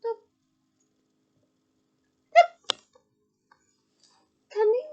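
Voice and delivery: high, pleading house-elf voice; a tiny tipsy elf fretting over her master; high elf squeak; squeaky elf. A narrator's reading. Winky the house elf speaks.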